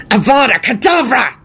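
high, furious shouted snarl; enraged spell shout. A narrator's reading. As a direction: snarl the curse with blazing fury. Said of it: "he snarled from the ground".

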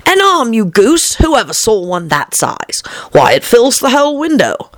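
high, scornful correction; exasperated scolding. A narrator's reading. The Rabbit scolding Pat, high and exasperated. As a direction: call him a goose with contempt. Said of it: "said the rabbit angrily".

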